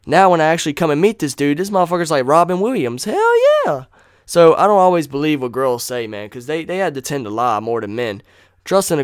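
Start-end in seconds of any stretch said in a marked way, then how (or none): 3.0-3.8 squealed high hell yeah; a sudden high excited yelp; high-pitched gleeful shout. Real, not acted.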